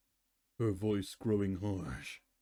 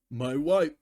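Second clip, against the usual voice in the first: cry out; 5 semitones higher, 7 dB louder than usual. Acted. A pony cries out before banishment.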